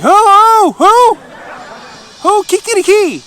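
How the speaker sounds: very high, loud crowed Who, who; rooster crow as who. Shown as a demonstration. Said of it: "So they're like".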